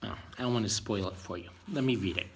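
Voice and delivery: ordinary delivery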